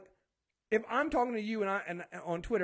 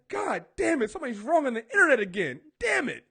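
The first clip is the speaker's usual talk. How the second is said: higher, louder hammed-up cursing with a laugh; mock frustrated outburst; 4 semitones higher, 7 dB louder than usual. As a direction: play-act rage at someone wrong online. Mock fury at internet.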